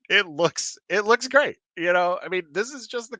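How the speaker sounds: excited. Real, not acted. Excited about the cover.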